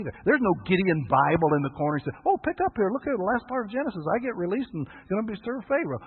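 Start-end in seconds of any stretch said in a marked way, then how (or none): 2.3-6.0 squeaky, far higher, mocking glee; high mock-excited voice. Preacher mocks Joseph reading his future, high squeal. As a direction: play a naive man thrilled at the answer.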